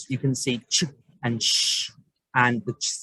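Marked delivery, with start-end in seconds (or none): 0.7-0.8 "ch" as one short burst, not letter names; isolated CH sound. Shown as a demonstration. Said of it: "C H". 1.4-1.9 "sh" as a voiceless hush, not letter names; isolated SH sound. Shown as a demonstration. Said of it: "the S H".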